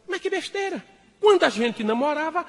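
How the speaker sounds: high, indignant wife voice; scolding wife. Acted. Wife nagging husband about the car, high indignant. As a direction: squeak out her complaint.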